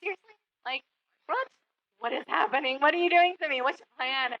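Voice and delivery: mock baffled asking what is happening; incredulous. Teacher retelling her baffled questions to God, mild disbelief. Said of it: "it's like".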